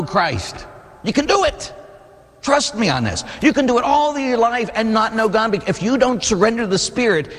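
high, urgent preaching climb; fervent preaching. Real, not acted. Priest urging his congregation to truly know Christ, fervent and high. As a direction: plead with the congregation, pitch rising.

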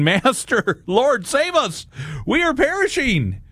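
high, drawn-out mock cry for help; panicked disciples. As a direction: plead in a hammed-up panic, pitch far up. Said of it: "We would be like the disciples saying".

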